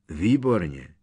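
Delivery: loud, irritated outburst; irked, punchy single word. A narrator's reading. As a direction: snap it out with annoyance. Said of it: "vyjel popuzeně".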